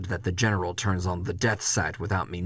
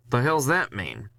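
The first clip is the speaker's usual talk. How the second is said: irritated question; 4 semitones higher than usual. Acted. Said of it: "I ask him".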